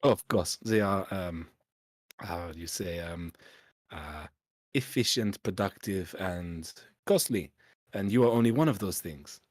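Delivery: hammed-up French accent, halting and searching for words; bad French accent. Snooty French innkeeper shooing the party off, bad French accent. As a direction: play it like a snooty Frenchman stumbling through English. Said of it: "my bad French accent".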